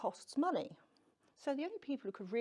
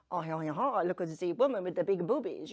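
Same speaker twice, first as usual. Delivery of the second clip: loud, hammed-up French accent; 4 semitones lower, 8 dB louder than usual. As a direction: play a leering bar patron in a comic French accent. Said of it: "I'm gonna do a French accent now".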